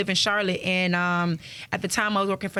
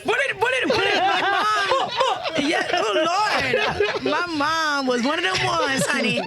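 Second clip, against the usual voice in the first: high, laughing confession; laughing admission; 9 semitones higher than usual. Real, not acted. Confessing her mother's wild past, laughing high. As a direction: spill it while still laughing.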